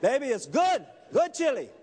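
loud, high, delighted exclamation; exuberant praise. Acted. Husband raves to his wife about her chili, exuberant. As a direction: burst out raving about the food.

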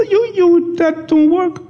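high squeaky voice, way up; high-pitched mock voice for the trap; mock squeaky rat trap. Preacher mocks a rat trap.

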